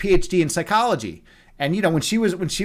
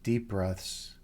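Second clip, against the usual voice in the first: much lower, much softer, slow meditation voice; guided meditation; 9 semitones lower, 14 dB quieter, slower than usual. Acted. Host guiding viewers into meditation, soft and slow. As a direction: ease them down into stillness with long pauses.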